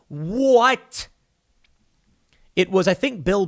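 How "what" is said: louder, higher incredulous "What?"; a confused yelp of disbelief; baffled what. Retold or imitated. Confused citizen reacting to mixed mask advice with disbelief. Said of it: "you're like".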